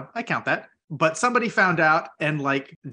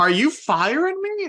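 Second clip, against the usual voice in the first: shocked disbelief; 11 semitones higher than usual. Retold or imitated. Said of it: "And I was just like".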